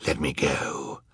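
soft, menacing villain's whisper; hushed villain menace; threatening whisper. A narrator's reading. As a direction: threaten under your breath. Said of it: "he whispered threateningly".

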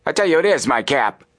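high sharp snap; angry snap. A narrator's reading. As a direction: snap it back hotly. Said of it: "snapped the swarthy man".

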